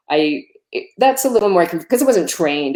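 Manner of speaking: normal talking voice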